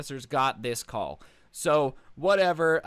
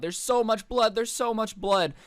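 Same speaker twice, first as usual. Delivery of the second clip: higher, louder panicked cry, imitating her; a hammed-up hysterical wail about blood; hysterical screaming; 7 semitones higher, 4 dB louder than usual. Girl at the door to the two men, hysterical cry. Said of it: "she's screaming about".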